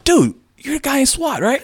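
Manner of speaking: loud, very high imitation of the cop; hammed-up high shout of recognition; excited recognition. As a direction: squeal it out like a delighted fan. Retold cop recognizes him.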